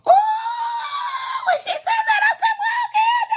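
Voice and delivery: very high, loud excited squeal; long squealed "ooh" running into high-pitched words; thrilled squeal. Real, not acted. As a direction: shriek it with scandalized delight. Thrilled reaction to racy line.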